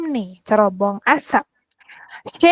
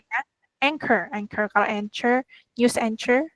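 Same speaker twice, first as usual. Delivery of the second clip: normal talking voice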